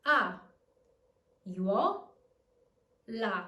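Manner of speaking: "A, uo, la" one at a time with clear pauses; teacher splitting "A, uo, la" into three pieces; syllable by syllable. Shown as a demonstration. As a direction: separate each syllable of "A, uo, la". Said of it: "let's divide the word in syllables".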